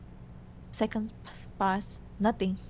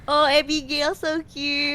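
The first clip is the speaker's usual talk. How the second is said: gushing; 7 semitones higher, 6 dB louder than usual. Retold or imitated. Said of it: "we were like".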